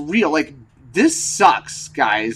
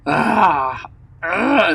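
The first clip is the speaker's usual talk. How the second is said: a short real crying ah, voice catching; real choked cry; 4 semitones higher than usual. Real, not acted. Rider missing the Mexico trip, choked real cry. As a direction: let the sound catch in the throat.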